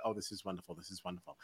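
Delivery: very soft, airy fake contentment; hushed mock delight. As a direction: murmur it like a contented sigh. Mock delight quoted while waiting.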